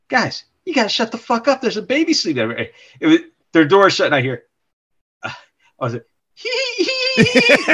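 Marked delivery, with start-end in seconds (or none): 0.1-2.5 high, exasperated telling-off; hushed scolding. Retold or imitated. Dad telling the guys to shut up for the baby, high exasperated scold. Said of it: "I'm like". 6.3-7.7 very high mock schoolgirl giggle; high giggling hee hee. Imitating the grown men giggling in their room, very high giggle. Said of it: "laughing, like, real loud".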